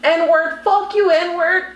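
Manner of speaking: high mock-angry chant; retold angry cursing. Teller imitating the woman seeing her call, mock anger.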